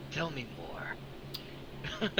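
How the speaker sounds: very slow, hushed whisper; whispered, drawn-out curiosity; intrigued whisper. Acted. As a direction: lean in and draw it out under your breath. Villain leans in, intrigued.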